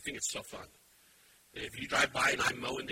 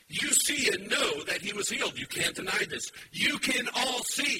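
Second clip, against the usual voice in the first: loud, rising preacher's call; fiery sermon voice; full-force preaching; 8 semitones higher, 3 dB louder than usual. Acted. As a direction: drive it home to the congregation.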